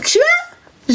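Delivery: very high squeaky suspicious question; mock high-pitched girl's voice; her wary reply. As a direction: squeak it out like a startled girl. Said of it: "Dès qu'elle va répondre".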